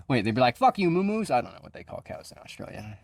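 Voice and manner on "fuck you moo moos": mock tough kangaroo. Kangaroo voice mocking cows.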